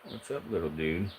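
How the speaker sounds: hushed, gentle hello to a pet; soft pet greeting. Real, not acted. Streamer quietly greets his corn snake Casper, gentle and hushed.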